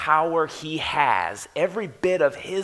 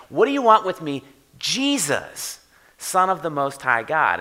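demon yelling; 3 semitones higher, 4 dB louder than usual. Retold or imitated. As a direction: confront Jesus by name, defiantly. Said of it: "this thing's yelling at Jesus".

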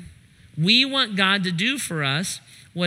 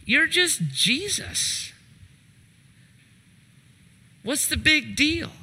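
dismissive mock; 8 semitones higher than usual.

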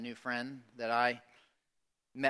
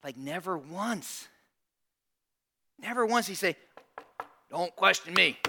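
emphatic denial; 6 semitones higher, 6 dB louder than usual. Retold or imitated. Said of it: "he's like".

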